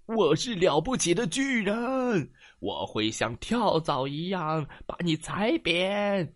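high, loud mock-menacing giant; a playful storybook threat; booming giant voice. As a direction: boast like a mighty giant. Hidden giant threatens the cat.

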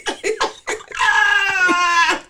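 loud high shrieking laugh; a real, high scream of laughter; howling laugh. Real, not acted. Bursts out after joke.

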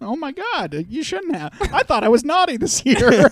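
high squealing delighted voice; excited child voice. Pretend. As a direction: gush like a thrilled kid. Bin Laden thanks Santa.

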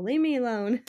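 usual speaking voice

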